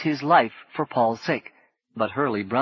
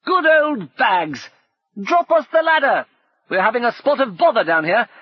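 cheerful shout; 12 semitones higher, 6 dB louder than usual. Acted. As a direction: call up to the airship in relief. Brumman calling to the airship.

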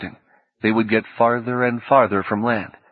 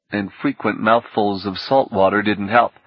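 plain reading voice

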